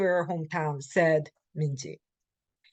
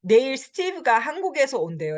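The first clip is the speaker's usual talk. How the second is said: quick casual hearsay, higher; 6 semitones higher, much faster than usual. Shown as a demonstration. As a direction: rattle it off lightly. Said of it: "mostly this is like this".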